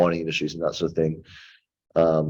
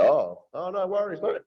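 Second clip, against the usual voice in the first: high, squeaky mock easygoing reply; mocking high no worries mate; relieved bloke; 14 semitones higher than usual. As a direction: pitch way up like a bloke caving in. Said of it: "for them to go".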